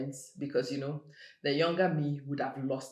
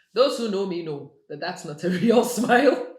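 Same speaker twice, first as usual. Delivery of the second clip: laughing loudly through the words; loud amused laugh; 7 semitones higher, 13 dB louder than usual. Real, not acted. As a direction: break into real laughter mid-line. Laughs at own fake smile.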